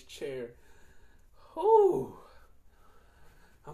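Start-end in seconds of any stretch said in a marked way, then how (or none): 1.5-2.2 high, loud whooped exhale; real high-pitched whew of relief; relieved whew. Real, not acted. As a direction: let out a loud, high whew after a great stretch. Relief after a big stretch.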